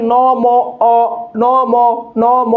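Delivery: "নম" drawn out, then "অ" isolated; deliberate repeats of "নম", vowels held; vowel drill. Shown as a demonstration. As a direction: lead the class in a sustained "নম" and a lone "অ". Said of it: "উচ্চারণ করেন তো আমাদের সাথে".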